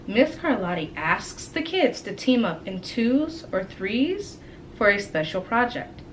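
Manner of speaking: ordinary delivery, nothing marked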